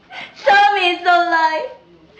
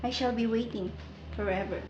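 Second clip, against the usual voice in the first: much softer, lower fading voice; fading whisper-soft; 10 semitones lower, 17 dB quieter than usual. Acted. Sabina promises George to wait, fading soft voice. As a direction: drift away as you speak.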